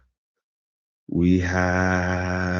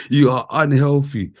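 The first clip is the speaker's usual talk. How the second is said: louder, higher playful scold; loud teasing verdict; mock scolding; 6 semitones higher, 6 dB louder than usual. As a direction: bark it out teasingly. Mock scolding flat earthers.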